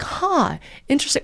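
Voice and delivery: soft, high, musing "huh"; intrigued reaction. Retold or imitated. Man hearing what her podcast is about, softly intrigued. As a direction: imitate quiet, puzzled interest.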